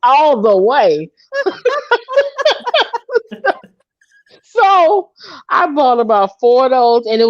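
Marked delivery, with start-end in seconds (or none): 1.3-3.5 high cackling laugh. Real, not acted. Laughing at her own story.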